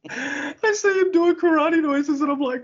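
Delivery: high, squeaky talk through laughter; slow, strained high voice, cracking up; laughing hard. Real, not acted. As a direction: barely get it out for laughing. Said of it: "I'm laughing so hard".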